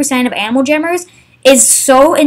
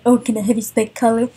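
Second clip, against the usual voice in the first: mocking whiny beggar voice; mocking imitation of a greedy player; 2 semitones lower, 6 dB quieter than usual. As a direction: imitate a pestering player, softer.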